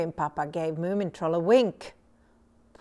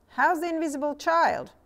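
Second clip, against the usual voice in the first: higher, curious neighbour's voice; a raised voice for the neighbour; higher voiced Tuutikki; 8 semitones higher, 4 dB louder than usual. A narrator's reading. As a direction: ask brightly, pitched up.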